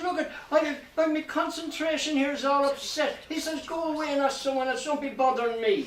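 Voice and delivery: irritated, high-pitched outburst; huffy and high, at normal volume; furious. Acted. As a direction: snap in a high, indignant voice. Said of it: "he was furious".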